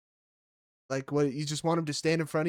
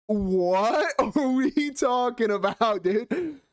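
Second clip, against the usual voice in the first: high, slow, laughing incredulity; recalling the post-fight interview, cracking up in disbelief; 8 semitones higher, 3 dB louder, much slower than usual. Retold or imitated.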